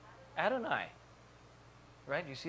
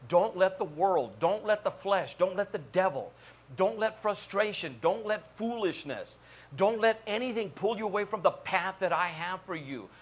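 higher-pitched, driving repeated warnings; emphatic preaching; 5 semitones higher than usual. Acted. Preacher urging congregation away from world, flesh and devil, emphatic preaching. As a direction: preach it as a rising list of warnings.

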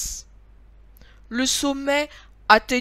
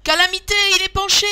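high, loud cry of alarm; high-pitched childlike outcry; children crying out; 11 semitones higher, 9 dB louder, much faster than usual. A narrator's reading. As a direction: exclaim like dismayed children. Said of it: "les enfants de s'écrier".

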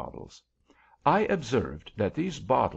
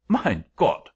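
put-on loud exclamation in German accent; startled German-accented angel; 3 semitones higher, 7 dB louder than usual. A narrator's reading. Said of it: "apparently much softened at my distress".

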